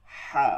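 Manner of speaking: "হা" squeezed lightly from mid-throat; airy throat-squeezed "হা"; light pressed ha. Shown as a demonstration. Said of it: "চাপ দিয়ে হালকা আওয়াজে".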